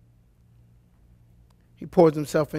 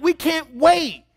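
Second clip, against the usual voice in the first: high, drawn-out mock eagerness; slow, pitched-up excitement; eager husbands; 8 semitones higher, 4 dB louder, slower than usual. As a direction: imitate dads who are itching for it. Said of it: "And we're like".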